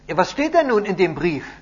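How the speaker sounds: high, loud, eager demand; impatient question. A narrator's reading. Captive presses messenger for the letter's contents, loudly impatient. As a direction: press for the news.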